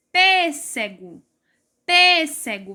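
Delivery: PÊ stretched and strong in pêssego; stressed first syllable. Shown as a demonstration. Teacher showing pupils where pêssego is strong, first syllable stressed. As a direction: lean on "pê" in pêssego, let the rest drop. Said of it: "em pêssego o P é mais forte".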